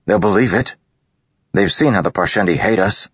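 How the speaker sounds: determined resolve. A narrator's reading. Kaladin explains staying behind.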